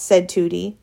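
usual speaking voice

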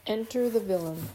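usual speaking voice

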